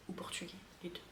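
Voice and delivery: a soft whispered aside. Real, not acted. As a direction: whisper it like a little secret. Aside after Spanish DNA.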